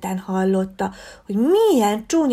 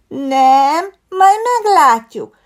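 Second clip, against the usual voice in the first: put-on defiant fox girl; 6 semitones higher, 9 dB louder, much slower than usual. A narrator's reading. Fox girl defies the bear.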